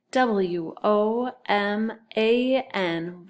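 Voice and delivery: slow, separated letters; one letter per beat; spelling aloud. Shown as a demonstration. Teacher leading sight word review, spelling aloud. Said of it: "and spell it".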